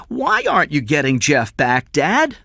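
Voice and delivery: put-on high, loud child's questioning; small boy. Young son challenging his father, high child voice. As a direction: demand an answer like a little boy.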